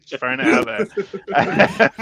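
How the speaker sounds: drawn out, laughing through it; slow laughing repeat. Real, not acted. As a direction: stretch it out while laughing.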